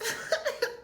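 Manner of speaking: high breathy laugh bursting out; sudden real giggle; burst of laughter. Real, not acted. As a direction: crack up at yourself.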